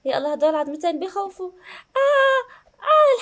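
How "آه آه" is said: sudden high yelp of relief, then a sigh; yelp, then breathe out the relief; high relieved yelp after the scare; relieved cry. Real, not acted. Relief after scary bones.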